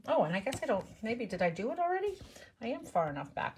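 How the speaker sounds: ordinary delivery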